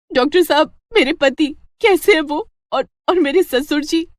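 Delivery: high, loud, halting anxious questions; panicked wife. Acted. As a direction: beg the doctor for news. Wife rushes to hospital after accident.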